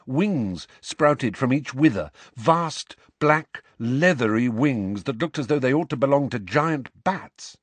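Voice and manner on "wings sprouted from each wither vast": ordinary delivery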